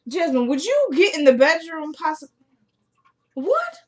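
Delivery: high, exasperated call across the house. Real, not acted. Telling Jasmine to get in the bedroom, exasperated calling.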